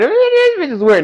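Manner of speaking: high, loud burst of disbelief; exaggerated squawking disbelief; mock incredulous outburst.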